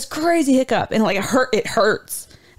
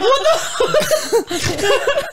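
laughing; 14 semitones higher than usual. Retold or imitated. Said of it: "I'm like".

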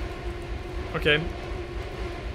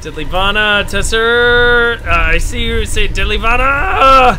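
loud confused shout; 5 semitones higher, 7 dB louder than usual. Real, not acted. Confused reading aloud, shouting.

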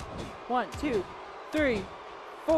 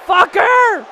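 loud, high shouted swear; real burst of gamer rage; angry curse; 6 semitones higher, 12 dB louder than usual. Real, not acted. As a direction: spit out a furious insult. Cursing at game opponent.

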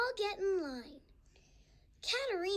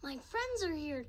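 plain reading voice

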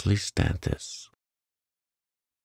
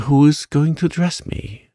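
high, louder indignant demand; 8 semitones higher, 4 dB louder than usual. Acted. Mary demands to know who will dress her, high and indignant. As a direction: insist like a spoiled child. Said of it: "demanded Mary".

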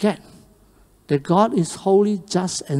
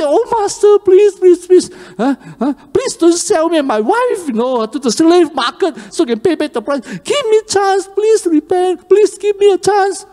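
desperate pleading; 10 semitones higher than usual. Acted. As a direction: beg for your life before the master. Servant begs master for mercy.

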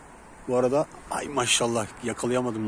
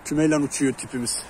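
usual speaking voice

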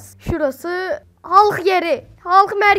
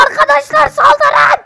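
high, drawn-out shout to charge; full-voiced mock commander's charge; battle cry; 10 semitones higher, 10 dB louder, slower than usual. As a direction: yell the attack order to your troops. Orders game troops to attack.

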